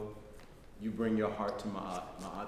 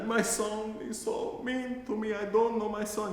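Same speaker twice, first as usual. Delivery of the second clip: put-on sad old Italian lady; 11 semitones higher, 3 dB louder than usual. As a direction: drag it out high like a grieving grandmother. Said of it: "She'd be like".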